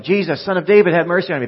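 loud, high beggar's cry; yelling. Acted. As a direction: yell for mercy over the crowd. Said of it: "He's yelling".

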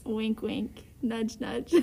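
low, hushed conspiratorial hint; soft, knowing undertone; sly aside. Pretend. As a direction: drop it softly like an open secret. Sly aside about playing a game.

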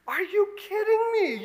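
high, loud are-you-kidding-me; high incredulous yelp. Retold or imitated. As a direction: shoot up high in disbelief.

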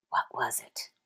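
a hushed, breathy whisper of a question; voiceless dramatic whisper. Acted. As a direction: lean in and whisper it. Whispered rhetorical question in sermon.

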